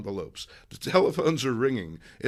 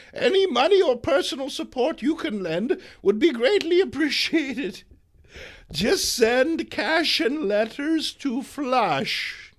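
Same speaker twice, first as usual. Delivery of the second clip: put-on crusading old lady; 10 semitones higher, 6 dB louder than usual. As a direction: sell the cause like a fiery granny. May pitching her cause.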